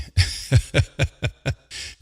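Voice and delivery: low, rolling chuckle; knowing chuckle. Real, not acted. Host agrees that Scotch gets real expensive, knowing chuckle.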